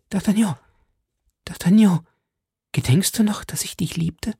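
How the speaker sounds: high, halting, fading to near whisper; stammering plea. A narrator's reading. Milady, newly hopeful, begs D'Artagnan, high and stammering. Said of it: "und stammelte".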